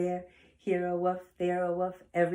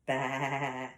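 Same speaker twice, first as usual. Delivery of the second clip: lower, drawn-out goat baa; goat bleat; 5 semitones lower than usual. Acted. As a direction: imitate a goat with a long, low bleat. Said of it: "And a goat says".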